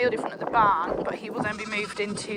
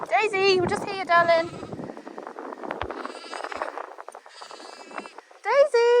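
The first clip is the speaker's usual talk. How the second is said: raised high call to a wandering dog; 8 semitones higher than usual. Real, not acted. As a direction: call her back, pitched up and carrying. Calling the dog from afar.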